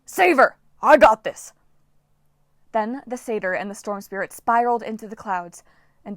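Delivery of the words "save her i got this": loud, high shout from above. Acted. Coach shouting down to Jason from the air, loud. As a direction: shout it as you're carried off. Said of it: "Coach Hedge shouted down".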